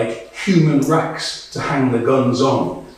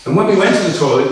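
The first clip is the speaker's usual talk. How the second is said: ordinary talk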